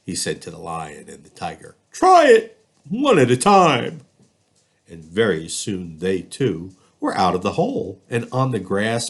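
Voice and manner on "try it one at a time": high, loud elephant voice; instructing elephant. Acted. Elephant lowering its trunk to the cats, high and loud. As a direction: tell them firmly what to do.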